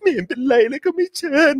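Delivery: high whiny sulking voice. Retold or imitated. Mocking the government sulking over the summit snub in a high whine. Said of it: "ออกมางอแงหลายอย่างเหมือนกันนะครับว่า".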